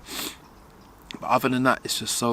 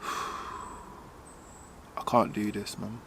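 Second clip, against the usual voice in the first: breathy sigh, then nearly voiceless; sighing, can't go on; 12 dB quieter, much slower than usual. Real, not acted. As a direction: barely get the words out, drained. Overwhelmed, sighing on camera.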